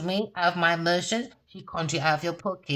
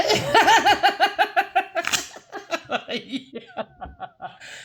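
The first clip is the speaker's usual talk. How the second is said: loud laugh; 10 semitones higher, 3 dB louder than usual. Real, not acted. Laughs at own insult.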